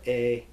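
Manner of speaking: "a" as the vowel in they; A sound. Shown as a demonstration. Teacher sums up the rule, "a" as in they. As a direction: say "a" to rhyme with they. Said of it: "pet, or they".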